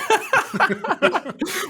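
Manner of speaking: real high-pitched laughing. Real, not acted. Laughs at the apology.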